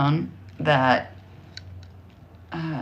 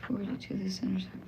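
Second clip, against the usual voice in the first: whispering; 2 semitones higher, 13 dB quieter, faster than usual. Real, not acted. Muttering while reading map.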